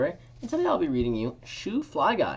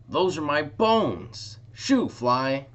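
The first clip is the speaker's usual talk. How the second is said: dog growling; 6 semitones higher, 7 dB louder, much slower than usual. Acted. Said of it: "A dog growled".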